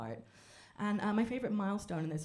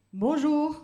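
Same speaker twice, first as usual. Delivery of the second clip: mock French greeting; 7 semitones higher, 10 dB louder than usual. His reply quoted.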